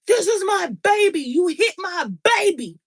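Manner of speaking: a hint of an angry elderly man; mock angry old man. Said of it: "he's already screaming at me".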